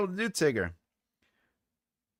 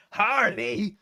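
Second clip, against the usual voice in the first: character voice; 7 semitones higher, 4 dB louder than usual. Retold or imitated. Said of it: "I can't do that very well".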